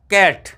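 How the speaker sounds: कैट said as one short word with the flat a of cat; phonics reading. Shown as a demonstration. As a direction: blend क and एट into कैट. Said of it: "कैट पढ़ेंगे इसको". (कैट को phonic ढंग से पढ़ें)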